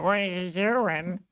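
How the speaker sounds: soft, slow, high-pitched sleepy mumble; sleepy Terry. Retold or imitated. Husband retells waking partner at night, sleepy mumble. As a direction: imitate someone half awake, drawn out and quiet. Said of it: "She was more sleepy".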